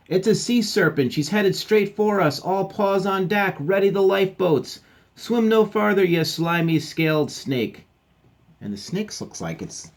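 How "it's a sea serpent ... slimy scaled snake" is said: high, loud battle orders; alarmed captain. Acted. Captain rallying crew against a serpent, high alarmed shout. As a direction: shout commands against a sea monster.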